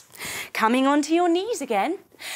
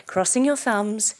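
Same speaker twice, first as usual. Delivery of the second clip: normal talking voice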